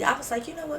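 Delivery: normal talking voice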